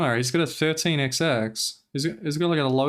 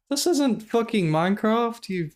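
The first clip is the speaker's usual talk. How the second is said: pitched up in fed-up disbelief; exasperated; 8 semitones higher than usual. Real, not acted. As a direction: drag it out in disbelief. Exasperated at streamer resetting.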